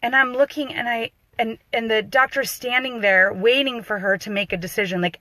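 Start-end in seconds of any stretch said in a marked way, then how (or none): none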